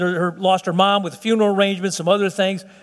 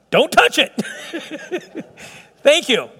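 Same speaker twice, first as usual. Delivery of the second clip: normal talking voice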